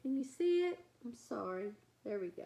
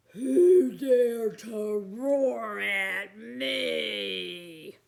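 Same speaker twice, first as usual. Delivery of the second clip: slow, louder monster growl; big monster voice; 4 semitones lower, 9 dB louder, much slower than usual. Acted. Big monster challenging the little ones, slow and menacing. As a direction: loom over them, slow and menacing.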